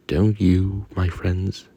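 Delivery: ordinary delivery, nothing marked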